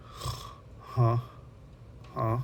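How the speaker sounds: breathless, whispered half-awake huh; groggy just woken. Retold or imitated. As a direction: sound dazed, like yanked out of a nap. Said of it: "woken up in the middle of the nap".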